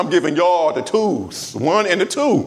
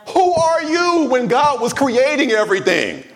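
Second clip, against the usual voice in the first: prophet's rebuke; 9 semitones higher than usual. Acted. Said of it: "act like the prophet that told Job".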